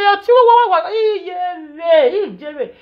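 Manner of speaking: wailing quote in a strange crying voice; mock wailing. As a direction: mimic the sister's tearful lament, mockingly.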